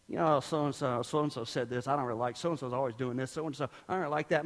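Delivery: low, quiet, hurried griping; mock grumbling under the breath; grumbling complaint. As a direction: mutter petty complaints in a rush. Acts out himself complaining at home.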